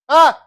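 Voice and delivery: very loud, high burst; short show-off scream. Acted. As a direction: yell suddenly to startle the room. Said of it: "Hey, bro, watch this".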